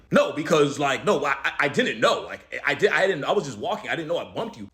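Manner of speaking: voicing a guy tripping over his excuses; nervous over-explaining. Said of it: "He starts over explaining".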